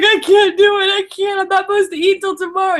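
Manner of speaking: high, squeaky panicked voice; mock panicked wail. Retelling her phone panic, high squeaky wail. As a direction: wail like a frightened child. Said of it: "I'm like".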